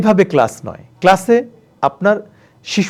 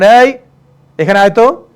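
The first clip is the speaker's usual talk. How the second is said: put-on louder, curt call to the house boy; bossy call to servant; 4 semitones higher, 6 dB louder than usual. Shown as a demonstration. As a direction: order the servant boy over. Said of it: "কাজের ছেলেকে বলবেন".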